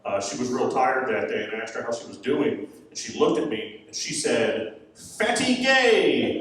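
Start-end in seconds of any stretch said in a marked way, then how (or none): 4.9-6.4 put-on high, long drawn-out toddler voice; imitated small child. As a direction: stretch it out slowly, mimicking a three-year-old. Retold three-year-old's tired insult.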